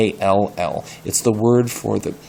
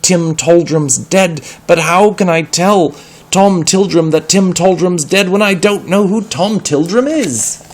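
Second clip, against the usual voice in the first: higher, louder pleading; desperate insisting; 9 semitones higher, 7 dB louder than usual. Acted. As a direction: insist he's telling the truth.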